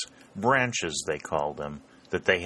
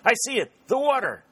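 loud, high-pitched cry; excited shout; 5 dB louder, faster than usual. A narrator's reading. Dick sighting the brook as he runs, shouting. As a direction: shout out the discovery. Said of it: "shouted Dick".

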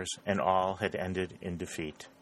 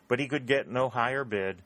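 ordinary delivery

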